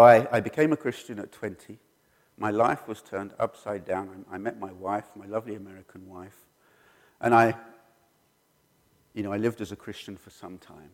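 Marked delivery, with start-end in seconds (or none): none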